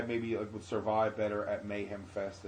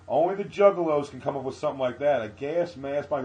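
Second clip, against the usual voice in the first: higher, louder clown MC; Upchuck; 5 semitones higher, 11 dB louder than usual. Retold or imitated. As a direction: play to the crowd, amazed. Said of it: "hosted by a clown named Upchuck".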